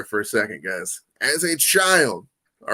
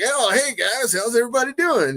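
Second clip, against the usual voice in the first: light, much higher chirpy hello; breezy casual greeting; 10 semitones higher than usual. Shown as a demonstration. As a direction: greet the room like a cheery host. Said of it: "It's not like how I'm saying it".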